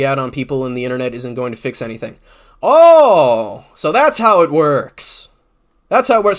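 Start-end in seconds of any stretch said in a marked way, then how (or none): 2.6-6.4 loud slow sarcastic realization. Pretend. Sneering at a commenter's logic, slow and sarcastic. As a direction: drag it out, mock-discovering.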